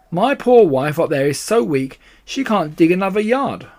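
high, loud lament; worked-up storybook badger; distressed Badger. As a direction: complain in despair for your wife. Narrator voices despairing Badger.

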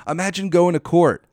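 ordinary delivery

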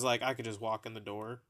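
ordinary talk

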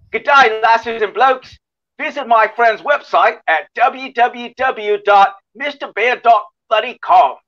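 put-on high, slow, over-the-top Australian accent; hammed-up Aussie accent. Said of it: "wanna hear an Aussie one".

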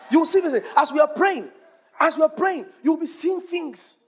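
high-pitched, insistent proclamation; fervent prophetic declaration. Real, not acted. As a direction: declare it over the congregation with rising fervour. Preacher prophesying over praying congregation.

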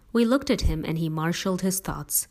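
ordinary delivery, nothing marked

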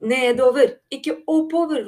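loud, high, urgent shout; shouted correction. A narrator's reading. Håp redirects the animals in danger, shouting. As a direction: shout to turn them the other way. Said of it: "ropte Håp".

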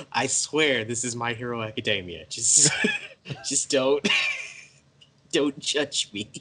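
laughing, pleading excuse; laughing plea. Retold or imitated. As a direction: beg through giggles. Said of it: "It's like".

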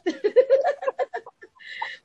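loud, breaking into laughter; amused teasing. Real, not acted. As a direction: tease loudly, then burst out laughing. Teasing colleague's fierce face, laughing.

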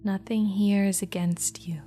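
ordinary talk

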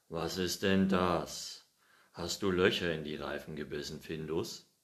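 a bit cross. Acted. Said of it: "schimpfte er, nun schon ein bisschen böse".

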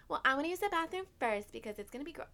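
mock a sister claiming first turn; imitating sister. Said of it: "I remember my sister being like".

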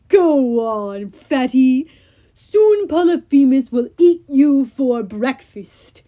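loud, slow giant voice; Cyclops voice. Acted. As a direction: boom it like a brutish giant.

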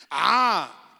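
ordinary talk, nothing marked

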